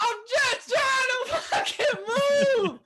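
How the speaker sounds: very high, slow, crying shout; desperate cry. Retold or imitated. Stuck in the street with fire trucks honking, crying shout. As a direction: wail it near tears. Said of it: "I'm like".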